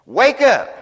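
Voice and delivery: loud, high cry to wake up; rousing shout. Acted. Preacher shouting at the sleepy church to wake. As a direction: shout it across the congregation. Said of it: "preacher calls out".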